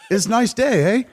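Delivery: high, puzzled Canadian upward lilt; Canadian lilt ending on a questioning "eh"; quizzical Canadian accent. Shown as a demonstration. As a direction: parody a Canadian, puzzled by everything. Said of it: "everything is almost quizzical".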